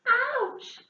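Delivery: high squeak of pain; squeaked ouch. Acted. Hedgehog squeaking as the apple lands, high squeak. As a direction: squeak it like a small hurt animal. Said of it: "squeaked Hedgehog".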